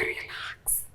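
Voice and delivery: breathy whisper, barely voiced; whispered relax. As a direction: hush her with a whispered Relax. Calming her upset sister.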